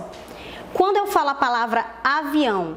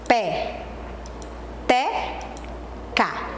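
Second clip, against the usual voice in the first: "Pé. Te. Ca." as three isolated syllables; slow syllable-by-syllable teaching demo, "Te" lifted, "Ca" dropping; very paused syllables; 4 dB quieter, much slower than usual. Shown as a demonstration. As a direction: leave a clear silence after each of "Pé", "Te", "Ca". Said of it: "Vamos falar bem pausadamente".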